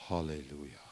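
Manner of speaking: low, soft, flat hallelujah; lifeless hallelujah. Pretend. Mocking a dull congregation's reply, flat hallelujah. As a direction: mumble it without any joy. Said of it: "man kann ja nicht sagen".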